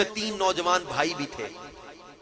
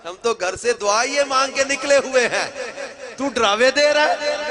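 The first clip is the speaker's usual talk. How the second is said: loud, high, passionate declaration; fervent defiance; 9 semitones higher, 8 dB louder than usual. Acted. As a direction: cry it out with burning devotion. Brothers' defiant reply. (جوشیلا بلند جواب)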